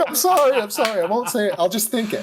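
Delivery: mock apology. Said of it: "I was like".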